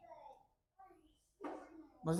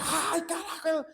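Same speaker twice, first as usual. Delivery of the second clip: high, drawn-out cry of outrage; loud demonstrated outburst; acted shout; 9 semitones higher, 4 dB louder, slower than usual. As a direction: yell it out suddenly, pitched way up. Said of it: "usted grita".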